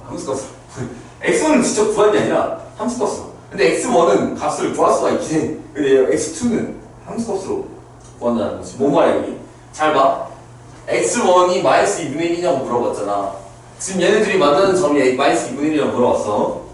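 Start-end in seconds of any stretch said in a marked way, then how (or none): none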